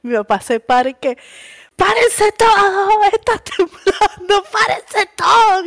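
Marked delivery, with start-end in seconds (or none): 1.8-5.7 high, slow mock alarm shout; dad yelling that it's an earthquake; imitating a yelling dad. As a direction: bellow for everyone to get up.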